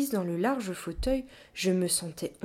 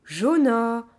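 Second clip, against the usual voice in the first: loud, drawn-out call of a name; 3 semitones higher, 8 dB louder than usual. Acted. Gorilla calls the child from the yard, calling out.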